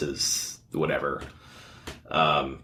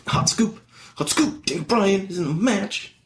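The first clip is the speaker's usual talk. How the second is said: excited blogger; 11 semitones higher than usual. Retold or imitated. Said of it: "go to their wrestling blog and be like".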